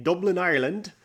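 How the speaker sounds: put-on a quick, half-hearted Irish accent on the place name; stuffy-nosed joke Irish accent, barely there; terrible Irish accent. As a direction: toss off a bad Irish lilt for Dublin. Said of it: "sorry for that terrible, stuffy Irish impre-, impression".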